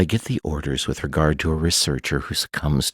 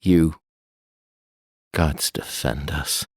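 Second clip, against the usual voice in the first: shocked dread. A narrator's reading. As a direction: let it sink to a hush. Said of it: "made Crane speak as he had".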